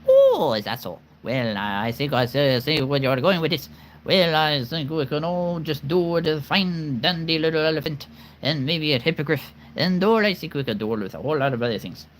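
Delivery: rattled-off character patter; fast talking. Shown as a demonstration. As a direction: run the words together in a playful rush. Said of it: "Talking fast is something".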